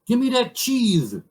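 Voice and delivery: high-pitched moose calling out; demanding moose. Acted. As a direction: holler up to a crow in a tree. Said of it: "say the moose".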